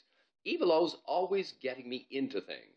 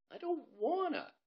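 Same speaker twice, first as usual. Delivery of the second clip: much higher, slow whimper; whiny scared refusal. Acted. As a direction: whine like a frightened boy. Bob refusing to jump.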